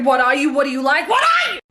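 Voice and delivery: rising to a yell; building shout. Acted. Gen Z character demanding an answer, shouting. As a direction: build from loud to screaming.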